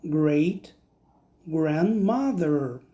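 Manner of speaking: slow careful "great grandmother"; English word. Shown as a demonstration. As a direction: say "great grandmother" slowly, stretching "grandmother". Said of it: "eu vou falar". (pronúncia lenta de "great grandmother")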